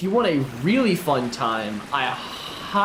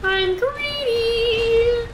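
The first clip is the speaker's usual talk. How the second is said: very high, slow squeal; squealed greedy; much slower than usual. Pretend. Gamer confessing greed to audience, very high slow squeal. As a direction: stretch it out like a cartoon squeal.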